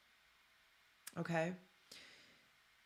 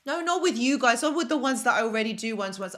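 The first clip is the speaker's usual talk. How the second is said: louder, fast reassurance; eager clarification, rushed and louder; hasty correction; 4 semitones higher, 8 dB louder, faster than usual. Real, not acted. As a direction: quickly correct yourself so nobody's offended. Hasty correction to her viewers.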